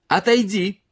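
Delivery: a woman yelling at a man to back off; shouting. A narrator's reading. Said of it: "выкрикивала она".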